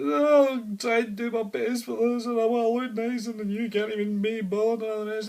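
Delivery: a hint of a weepy wife pleading; mock tearful wife. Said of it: "So she's mumbling away".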